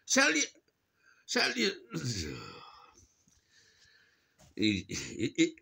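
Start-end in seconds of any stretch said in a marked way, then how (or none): 0.0-1.7 high mocking parrot of the jargon; mocking the careers adviser's jargon, high; mimicking the adviser. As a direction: sing it back in a high mocking voice. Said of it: "What do you mean, sell myself?".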